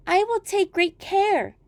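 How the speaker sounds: put-on high, slow and sweet girl promising; a small girl answering her mother; little girl voice.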